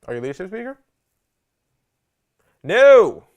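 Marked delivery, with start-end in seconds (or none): 2.6-3.2 emphatic no. Acted. Correcting audience.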